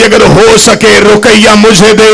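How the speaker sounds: wailing sung lament; weeping plea. A narrator's reading. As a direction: plead through tears. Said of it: "रो के दी".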